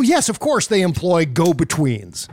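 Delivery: ordinary delivery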